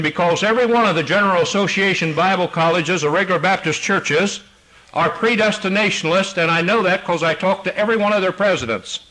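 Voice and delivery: high, loud pulpit preaching; preaching at full force. Acted. Pastor preaching to his congregation, loud and high-pitched. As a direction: drive every line home from the pulpit.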